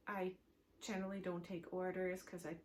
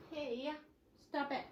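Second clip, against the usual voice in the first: quick, higher, firm little telling-off; real quick scold at a pet interrupting; scolding the cat; 5 semitones higher than usual. Real, not acted. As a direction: shoo the cat off, half-distracted. Telling off her cat mid-recording.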